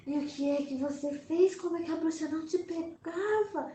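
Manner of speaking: a lightly voiced scared child asking; child asking in awe. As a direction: ask like an amazed child. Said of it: "e eu falava assim".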